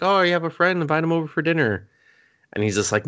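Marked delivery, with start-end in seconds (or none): 0.0-1.9 higher, chirpy mom voice; a cheery mother, quick and bright; sweet mom. Retold or imitated. Mom finding son with a friend, higher cheery imitation. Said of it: "the kid's mom walks by and is just like".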